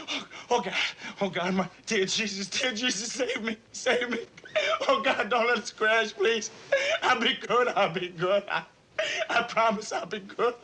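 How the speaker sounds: desperate whimpering plea; terrified praying. Acted. Praying not to crash.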